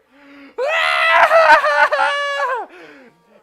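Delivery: high, loud long wailing cry; distant wail. Retold or imitated. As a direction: howl like a kid being spanked. Hearing a spanking through wall.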